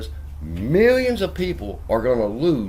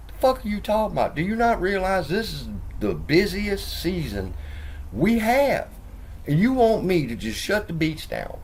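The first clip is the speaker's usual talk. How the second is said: higher, fast mock outrage; scoffing mayor; 9 semitones higher than usual. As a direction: play the dismissive mayor, incredulous.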